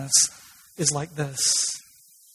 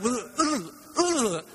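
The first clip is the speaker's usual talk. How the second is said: high, garbled mock convulsing; imitated convulsing noise; 5 semitones higher, slower than usual. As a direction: imitate someone convulsing, in short bursts. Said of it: "Smith starts convulsing, right? Like".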